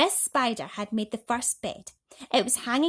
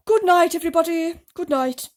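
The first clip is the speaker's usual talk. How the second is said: a louder, higher sleepy goodnight; old grasshopper; 8 semitones higher, 12 dB louder than usual. A narrator's reading. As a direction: call goodnight to the whole room. Grasshopper bids everyone good night.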